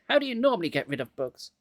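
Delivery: ordinary delivery, nothing marked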